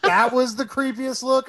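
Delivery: a slow, sulky echo of the friend's words; mock-offended echo. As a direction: repeat it back slow with pretend hurt. Echo is higher and slower.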